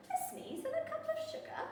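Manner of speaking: high, quick disbelieving echo; incredulous echo. Acted. A doubting listener repeats the wolf's claim, high and quick. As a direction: squeak it back in disbelief.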